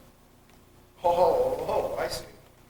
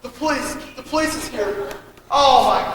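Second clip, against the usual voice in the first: ordinary talk, nothing marked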